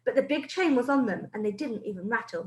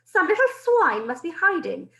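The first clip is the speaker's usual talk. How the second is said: witch voice; 6 semitones higher, 6 dB louder than usual. Acted. Witch sniffing out the boy.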